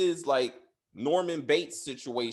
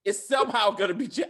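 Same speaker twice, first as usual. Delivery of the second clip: louder, insistent, rising on somehow; louder insistent repeat; 6 semitones higher, 5 dB louder than usual. Shown as a demonstration. Host repeating the prediction to the cohost, louder and insistent. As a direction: insist on it a second time. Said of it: "I, I know it".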